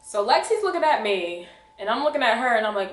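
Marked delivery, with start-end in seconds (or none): none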